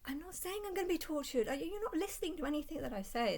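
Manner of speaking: high, fast, exasperated; high frustrated protest; exasperated retort. Retold or imitated.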